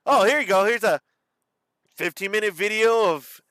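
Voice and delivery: upbeat presenter voice. Pretend. Imagined upbeat video intro.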